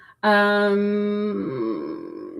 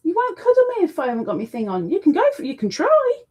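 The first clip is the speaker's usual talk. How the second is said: teasing the cat; 8 semitones higher, faster than usual. Real, not acted. Owner teasing her fussy cat in high baby talk. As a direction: coax the cat playfully.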